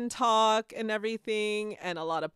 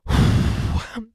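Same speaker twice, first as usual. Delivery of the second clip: loud, exaggerated unvoiced sigh; cartoon despair sigh; loud meme sigh; 4 semitones higher, 9 dB louder than usual. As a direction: heave a dramatic meme sigh. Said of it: "that SpongeBob meme when he's in the cave".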